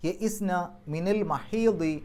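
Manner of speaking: slow, chanted Quranic recitation. Shown as a demonstration. Teacher recites the verse for students, slow chanted recitation. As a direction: intone the verse slowly and melodically. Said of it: "പാരായണ നിയമങ്ങൾ നോക്കാം".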